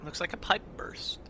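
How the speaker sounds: ASMR whisper. Pretend. Whispering through ASMR bit.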